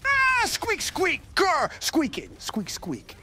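very high squeaks with a growl, falling away; frantic squeaks. Acted. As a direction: squeal and growl like an angry hamster. Joe squeaking as hamster.

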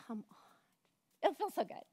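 breathless whisper; soft whispered no; whispered refusal. Acted. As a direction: hush it as if tempted. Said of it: "And you're like".

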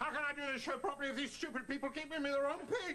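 angry rant. Acted. Puppeteer complaining about his helpers' mistakes, ranting. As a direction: rant quickly in exasperation.

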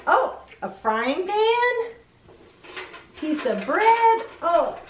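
high, bright reveals of each item; excited. Real, not acted. Unpacking the mailbox for kids, high and excited. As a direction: thrill at every find.

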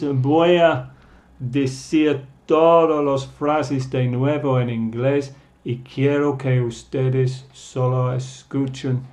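ordinary talk, nothing marked

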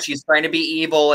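usual speaking voice

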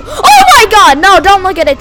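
loud, high cry dropping into urgent warning; alarmed shout. Real, not acted. Player warning not to look at the monster, alarmed shout.

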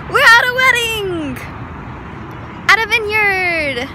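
high, loud party cheer; excited announcing. Real, not acted. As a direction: call it out like you're thrilled to be there. Announcing wedding party to camera.

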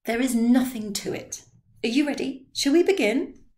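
lightly voiced keen old man; impatient old man. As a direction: hurry things along. Drioli impatient to start.

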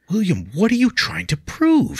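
urgent plea. Acted. Leah urgently questions William, high and loud. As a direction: press him hard, voice high. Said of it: "she asked urgently".

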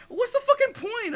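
high, loud exasperated outburst. Real, not acted. Gamer ranting about pointless upgrades, exasperated and loud. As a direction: throw the question up in disbelief.

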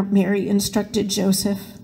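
plain reading voice

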